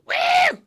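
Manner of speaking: very high, loud whooped squeal; mock squeal. Friend doing an impression, high loud squeal. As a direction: let out a piercing put-on woo. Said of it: "that's my other impression".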